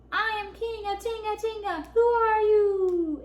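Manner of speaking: high, swelling booming answer; big echoing voice. A narrator's reading. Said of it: "answered a big voice".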